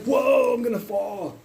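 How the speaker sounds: loud, high "whoa", then easing down; mock alarm. Teasing a friend on the ride, mock alarmed cry. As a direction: play up a fake panic about falling. Acts out fake slipping on ride.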